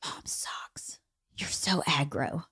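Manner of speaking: put-on very soft, low teen muttering; hushed mock complaint; retold sulky grumble. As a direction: imitate a sulking kid grumbling under the breath. Voices daughter's sulky grumbling.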